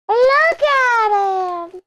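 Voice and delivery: very high, slow, drawn-out coo. Real, not acted. Cooing over the cat canvas.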